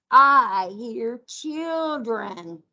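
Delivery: slow, drawn-out storybook reading; slow storytime read. Acted. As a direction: stretch it out for the children to follow. Teacher reads animal picture book.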